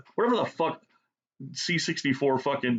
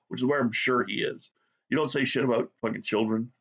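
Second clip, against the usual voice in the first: ordinary delivery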